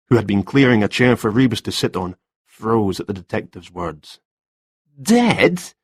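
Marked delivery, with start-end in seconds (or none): 5.0-5.7 high, disbelieving gasped question; shocked gasp. A narrator's reading. Man just told of a death, shocked high gasp. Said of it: "he gasped".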